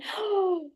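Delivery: high, soft surprised gasp; mock gasp of delight; sharp gasp of wonder. Athlete gasping as her body opens up, sharp and high.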